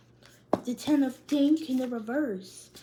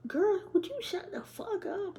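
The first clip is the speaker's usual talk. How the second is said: laughing; 7 semitones higher than usual. Real, not acted. Laughing at friend Jordan.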